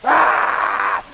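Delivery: long comic shriek; mock scream. Storyteller acting Red attacked by the wolf, mock scream. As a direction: let out a hammed-up scream.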